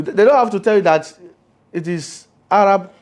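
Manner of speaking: usual speaking voice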